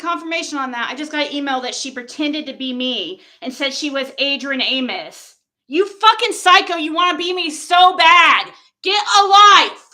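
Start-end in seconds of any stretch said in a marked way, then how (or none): 5.7-9.9 loud, high-pitched angry tirade; furious shouting. Real, not acted. Yelling at the woman who pretended to be her, furious. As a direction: yell it straight at the offender.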